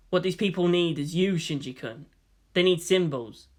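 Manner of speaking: ordinary delivery, nothing marked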